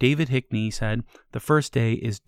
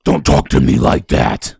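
demon voice; 11 semitones lower, 9 dB louder than usual. Acted. Possessed voice snaps.

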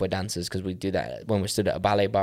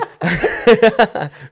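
loud, high real laughter; big laugh; 10 semitones higher, 8 dB louder than usual. Real, not acted. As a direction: laugh out loud, losing composure. Cracking up at an innuendo.

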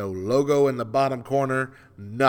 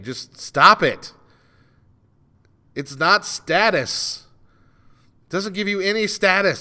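loud, emphatic, worked-up scolding; exasperated rant; 8 semitones higher, 7 dB louder than usual. Real, not acted. Host scolding people who leave tags on hats, exasperated. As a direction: lay it down loudly, fed up. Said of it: "I've made myself really mad".

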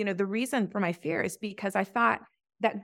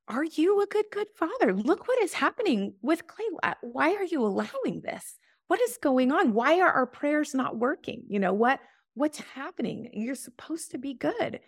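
much higher, pleading and accusing; a mother's heartbroken plea, pitched up high; retold anguished cry to God; 7 semitones higher than usual. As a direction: cry out to God in desperate confusion. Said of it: "And I just remember being like".

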